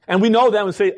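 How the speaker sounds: ordinary talk, nothing marked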